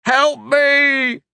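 high desperate scream of pain; shouting while wounded. Acted. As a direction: cry out for rescue, in agony. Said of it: "the man screamed in pain".

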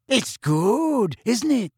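proud of her roaring lion hat, happily; happy dreamy girl. A narrator's reading. Luna showing off her lion hat, happy and dreamy. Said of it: "said Luna happily".

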